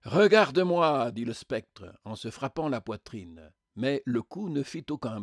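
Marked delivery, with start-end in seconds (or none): 0.0-1.1 higher, louder self-righteous command; insistent demand. A narrator's reading. Spectre striking his chest, loudly insistent. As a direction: demand to be looked at.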